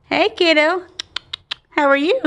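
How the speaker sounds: high rising pet greeting; talking to a dog. Real, not acted.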